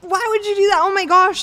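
high, loud mock outburst; aghast boyfriend impression, high and loud; shocked reaction. Acting out his startled reaction.

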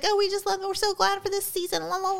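high, sing-song mimicry of gushing; mock gushing. Mimicking an actress promoting her show, mock gushing. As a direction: parody someone gushing in a high voice.

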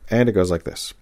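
ordinary delivery

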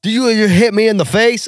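high, badly faked Australian; indignant kangaroo attempting an Australian accent; bad Aussie accent. Punched kangaroo confronting the man, bad Aussie accent. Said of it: "I can't do an Aussie".

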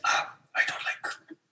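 a hushed, slowed whisper of distaste; whispered dislike. Retold or imitated. Friend quietly rejecting a bitter blue drink, whispered.

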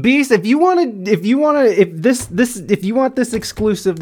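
high, loud eager plea; excited. Real, not acted. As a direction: push him excitedly to spill. Excited pleading with guest.

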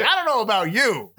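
high doubtful line; quoting Kid. Retold or imitated. Friend doubting the pal in Jeep, high mock doubt. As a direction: say it way up, unsure of him. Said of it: "He's like".

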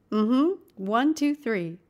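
lifted pitch, falling away on three. Shown as a demonstration. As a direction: answer mm-hmm and count at that pitch. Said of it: "at the same pitch".

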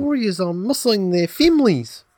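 high, loud New Zealand caricature; mock Kiwi accent.

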